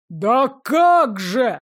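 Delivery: slow, drawn-out outraged bellow; indignant roar. Acted. Angry father bellows at the steward, outraged roar. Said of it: "взревел тот возмущённо".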